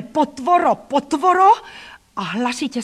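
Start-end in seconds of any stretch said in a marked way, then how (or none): 0.0-1.5 high, loud repeated yell; furious high-pitched name-calling; shouted insult. A narrator's reading. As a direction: yell the insult, climbing higher. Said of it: "křikl na mne".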